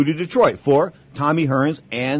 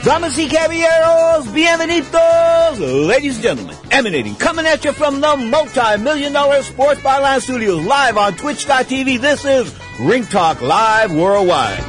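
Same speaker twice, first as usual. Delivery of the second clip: booming high ring announcer voice; lucha libre announcer; 9 semitones higher, 4 dB louder than usual. Acted. Radio show intro announcement.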